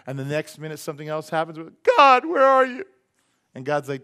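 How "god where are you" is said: high, loud plea flung upward; mock desperate wail to God; whiny desperate cry. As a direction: cry out like you feel abandoned. Imitating believers crying to God.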